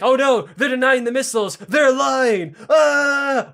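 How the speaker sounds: high, loud, hammed-up alarm; mock panic. Storyteller voicing panicked officials over denied missiles, mock panic. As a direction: play a comic panic, rising into a yell.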